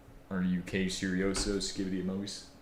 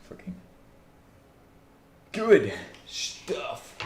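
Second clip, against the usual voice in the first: mock praise; 8 semitones higher, 4 dB louder, much slower than usual. Sarcastic praise of the process.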